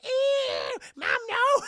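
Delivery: very high, loud disgusted shriek; high girl shriek. Retold or imitated. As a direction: squeal it high and loud, grossed out.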